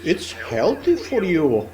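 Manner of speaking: slow, drawn-out questions with swooping pitch; leading questions. Retold or imitated. Americans asking in front of the parents, slow coaxing questions. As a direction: draw each question out, lifting the end. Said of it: "in front of the parents asking me".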